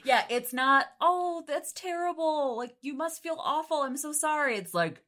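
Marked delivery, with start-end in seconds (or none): 1.0-4.6 high-pitched, gushing pretend concern; mock sympathy. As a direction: coo pity in a put-on high voice. Mock sympathy a wife didn't give.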